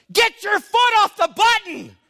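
high, acted yell. Brother yelling at him in the fire truck, yelling. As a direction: yell it like an exasperated order. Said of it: "He's yelling at me something".

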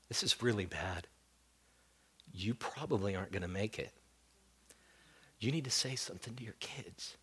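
soft, hushed bad news; whispered medic warning; grave whisper. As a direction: break it gently, barely voiced. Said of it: "he says".

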